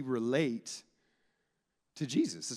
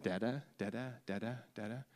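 softer, lightly babbled dada, rising; baby babbling; 3 semitones lower, 8 dB quieter than usual. Retold or imitated. Toddler babbling at dad, lightly imitated. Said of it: "your kid, when they start early".

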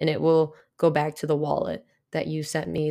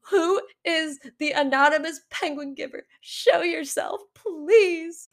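mock distressed plea; 9 semitones higher, slower than usual. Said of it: "I was like".

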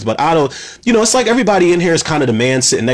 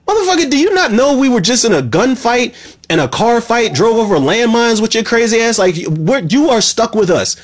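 much higher and quickly heated; a fed-up fan's outburst, real frustration; exasperated rant; 7 semitones higher than usual. Real, not acted. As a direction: vent at him, pitch climbing fast. Ranting at Dom going alone.

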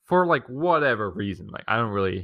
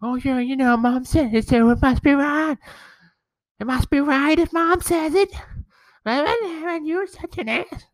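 much higher, snotty imitation of his sister; mocking sister impression; 4 dB louder than usual. Imitating his sister sneering at him at dinner, high and bratty. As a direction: squeak it out like a bratty know-it-all, stretching the last line slowly. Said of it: "she does her usual asshole thing".

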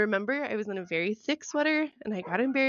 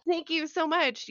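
higher, bright fake-polite reassurance; mock cheerful; 7 semitones higher than usual. Bleeding woman insisting to her date it's a great date, fake cheerful. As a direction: pretend the disaster is a lovely date. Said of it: "And I'm sitting there like".

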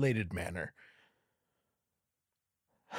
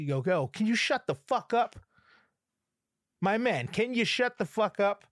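exasperated telling-off; 9 semitones higher than usual. Acted. Fed-up man telling the crows off, exasperated. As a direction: plead crossly with noisy neighbors to shut up.

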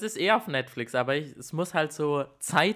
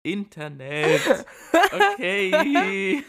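laughing; 6 semitones higher, 7 dB louder, much slower than usual. Real, not acted. Laughing at own slip.

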